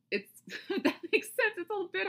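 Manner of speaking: lachend en hoog, een giechel tussen de woorden; laughing. Real, not acted. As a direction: lach het luchtig weg. Laughs mid explanation.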